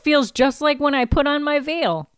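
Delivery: hammed-up wistful heroine; mock bride. Teasing the show's flashback as the heroine, mock bride voice. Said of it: "She's like".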